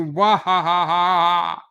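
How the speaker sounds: loud hammed-up villain laugh; mock evil laugh ending a threat; evil cackle.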